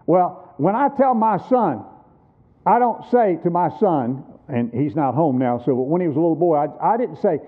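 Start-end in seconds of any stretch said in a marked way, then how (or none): none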